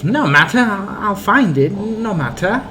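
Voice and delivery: put-on composed old man. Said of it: "stops and composes himself".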